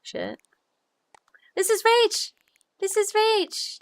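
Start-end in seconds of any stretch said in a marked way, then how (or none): 1.5-3.8 high, loud gleeful introduction; excited announcing. Pretend. Streamer presenting her just-married friend, excited announcing. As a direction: squeal it out as a playful announcement.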